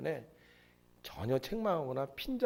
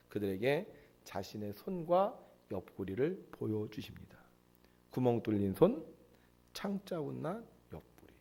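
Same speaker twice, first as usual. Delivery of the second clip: whisper; 3 semitones lower, 5 dB quieter than usual. Real, not acted. Preacher hushing as Jesus shows his wounds, whispered. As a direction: whisper it reverently. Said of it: "whispered narration".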